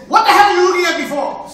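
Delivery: loud, high, ready for a fight; snapping at a man in a restroom; defensive yell. Retold or imitated. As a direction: bark at the stranger. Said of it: "I said".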